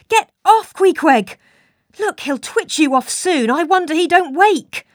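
high, loud, urgent pleading; agitated alarm. A narrator's reading. Narrator begs Queequeg to get off the sleeper, high and loud. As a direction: urge him off in rising alarm.

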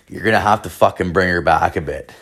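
ordinary talk, nothing marked

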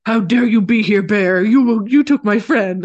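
loud, offended dog voice; indignant dog voice. Retold or imitated. Storyteller voicing the dog at the bear, loud and indignant. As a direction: bark it out like an outraged dog.

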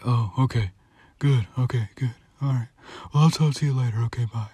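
quiet, far-off sleepy mumble; barely awake, slurring into the phone; groggy half asleep. Retold or imitated. Husband woken by wife's call, groggy and quiet. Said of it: "I'm still half asleep".

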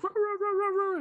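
high-pitched mock dog barks; imitated dog barking. Retelling the dogs at the door, mock barking. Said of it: "my dogs are like".

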